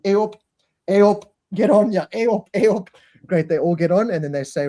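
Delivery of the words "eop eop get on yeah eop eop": high, hammed-up Yorkshire lady herding passengers. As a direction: call passengers aboard like a Yorkshire flight attendant. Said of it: "proper Yorkshire lady".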